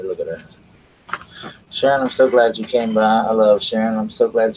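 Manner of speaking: normal talking voice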